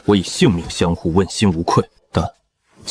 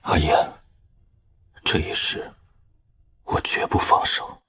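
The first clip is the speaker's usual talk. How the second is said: very soft, mostly voiceless murmur; tender whispered vow; 2 semitones lower, 5 dB quieter than usual. Acted. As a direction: vow it intimately under the breath. Wounded husband's tender vow. (气声低语，深情起誓)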